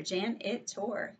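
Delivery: "janitor" slow, split into three parts; syllable by syllable. Shown as a demonstration. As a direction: sound out each beat of "janitor". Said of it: "three syllables".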